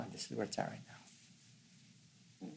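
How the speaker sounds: very soft, slow near-whisper; hushed noticing. Retold or imitated. Showing how to really know the moving mind, hushed noticing. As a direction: let it sink almost to a whisper, slowly.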